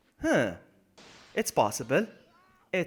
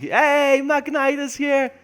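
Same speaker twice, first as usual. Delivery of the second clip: loud, high, drawn-out greeting; big hyped welcome; excited shout-out; 11 semitones higher, 8 dB louder, much slower than usual. Real, not acted. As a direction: hail a new arrival at full volume. Hyped welcome to arriving viewer.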